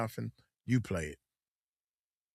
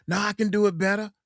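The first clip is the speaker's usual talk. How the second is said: stubborn amateur; 9 semitones higher, 5 dB louder, faster than usual. Retold or imitated. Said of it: "they think they the producer".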